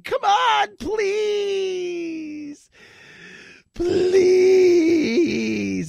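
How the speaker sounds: high, loud pleading yell with a crying edge; pleading scream. Retold or imitated. Country begging the fighters, loud desperate plea. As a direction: beg at full voice, nearly in tears.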